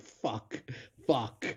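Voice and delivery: hushed curse under the breath; muttered curse. Retold or imitated. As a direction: mutter it to yourself, softly. Muttered curse at scary scene.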